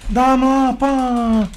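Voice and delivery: high, loud, long-drawn call. Real, not acted. Gamer calling for the ball, drawn-out shout. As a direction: stretch the demand out high and loud.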